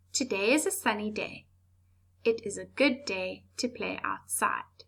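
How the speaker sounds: calm reading with clear pauses at each full stop; calm corrected version with clear stops. Shown as a demonstration. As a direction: read it slowly, stopping at each full stop. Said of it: "It's calmer, slower, and we can understand".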